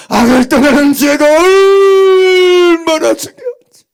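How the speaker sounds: tearful drawn-out plea; crying cry. Real, not acted. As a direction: cry it out to the congregation. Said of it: "crying loud repeat".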